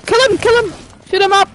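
high, frantic command to a teammate; urgent yelling. Real, not acted. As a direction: urge them on at a high pitch. Urging teammate in firefight.

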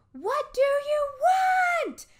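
very high, loud, slow shriek; terrified scream. Acted. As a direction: scream at the closing ghosts. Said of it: "I screamed in a voice".

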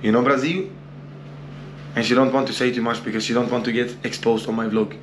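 normal talking voice

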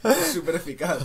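high, loud Pokémon-style announcer; game announcer. Acted. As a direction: quote the Pokémon line with glee. Pokémon catchphrase joke.

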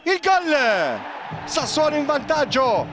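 rising live goal call; breathless italian goal commentary. Acted.

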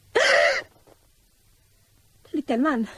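high, loud, drawn-out cry of shock; shocked discovery. Acted. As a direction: recoil at the sight of the body. (entsetzter, gedehnter Aufschrei)

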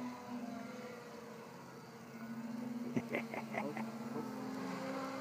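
high airy giggling laugh; long breathless squeal of laughter; cartoon laugh. Real, not acted. Long squeaky laugh after crash.

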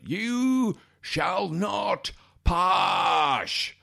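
high, hugely drawn-out Scottish wizard bellow; hammed-up Scottish Gandalf roar; Sean Connery. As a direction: stretch every word, pass becoming "pash". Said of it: "Sean Connery in that role".

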